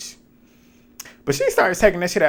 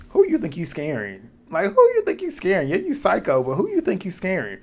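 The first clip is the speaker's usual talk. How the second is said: mocking challenge; 6 semitones higher than usual. Host taunting Jesse's cliff threat, high mocking disbelief. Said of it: "I said".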